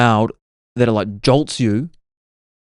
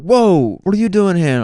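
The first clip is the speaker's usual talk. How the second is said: high, louder startled "Whoa"; pitched up, a louder retold surprise; surprised whoa; 8 semitones higher, 4 dB louder than usual. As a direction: act caught off guard. Startled girl in dream retold.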